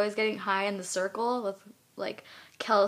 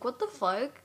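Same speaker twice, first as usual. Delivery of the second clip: ordinary delivery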